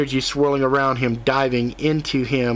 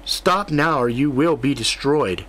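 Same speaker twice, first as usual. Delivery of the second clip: ordinary delivery, nothing marked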